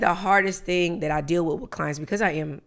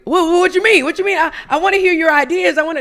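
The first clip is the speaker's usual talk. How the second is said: high, louder, baffled objection; retold protest; 8 semitones higher, 6 dB louder than usual. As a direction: imitate someone protesting in disbelief, pitched up. Acts out protesting to a client.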